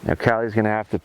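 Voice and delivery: normal talking voice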